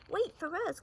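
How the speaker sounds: high, loud call after him; cried out. Acted. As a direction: friends calling to the running bear. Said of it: "cried Lippity Rabbit and Very Small Frog".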